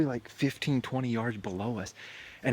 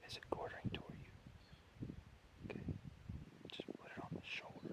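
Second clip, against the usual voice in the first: whispering; 14 dB quieter than usual. Real, not acted. Hunters whispering in tree stand.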